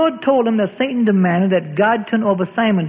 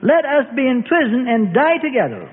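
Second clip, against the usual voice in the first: higher, earnest pleading protest; a lightly voiced Peter insisting he's ready; raised, fervent plea; 6 semitones higher than usual. A narrator's reading. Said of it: "protested and insisted".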